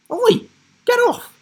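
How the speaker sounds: high, loud indignant shout; the tree shouting at Rhea to get off; angry shout. A narrator's reading. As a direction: yell at the intruder. Words on newspaper tree.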